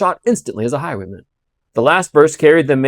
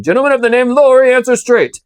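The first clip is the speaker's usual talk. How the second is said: loud high demand; commanding shout; 10 semitones higher, 6 dB louder than usual. Acted. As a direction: bark the order. Guard demanding from the mist.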